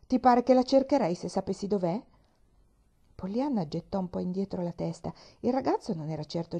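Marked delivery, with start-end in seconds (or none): 0.1-2.0 snapping at a silly question; irritated, a bit louder and higher. Acted. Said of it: "la guardò con fastidio". (con fastidio, più forte)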